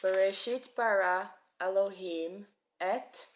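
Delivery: "Bereshit bara Elohim et" read slowly and loudly, word by word; slow Hebrew reading. Shown as a demonstration. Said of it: "teacher models reading".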